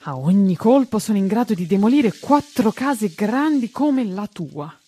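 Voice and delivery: big loud boast from a factory boss; shouting. A narrator's reading. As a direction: shout it out over the din. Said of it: "gridò il signor Bombardino".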